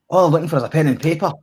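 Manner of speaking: lightly voiced Scottish plea, high, loud and fast; a hint of a different Scottish accent, pressing; mock Scottish quote. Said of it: "I don't want to put on a Scottish accent".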